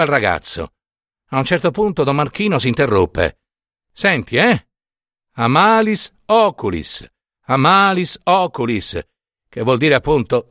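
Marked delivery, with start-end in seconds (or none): 3.9-9.0 prete che salmodia lo scongiuro. Acted. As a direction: recita la formula come una nenia. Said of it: "cominciò a miagolare lo scongiuro". (il prete interrompe il rito per spiegare la formula, cantilena lenta)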